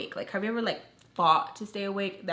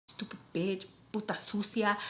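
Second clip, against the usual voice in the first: soft, understated insult; muted insult; 6 dB quieter than usual. Retold or imitated. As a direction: slip the insult out under your breath. Insult thought to test mind reading.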